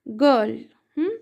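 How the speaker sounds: ordinary talk, nothing marked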